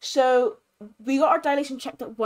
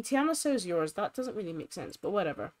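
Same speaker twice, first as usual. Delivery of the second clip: ordinary talk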